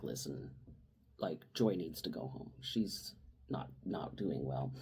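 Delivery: low, very soft voice; imitating a discreet word to the boss; hushed, confidential. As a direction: break it gently, almost under the breath. Retold discreet call to boss.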